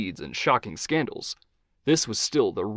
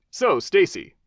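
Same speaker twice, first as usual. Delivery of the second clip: higher, upbeat host voice; talk-show host Johnny; 7 semitones higher, 4 dB louder, slower than usual. Acted. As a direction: charm the guest as a TV host.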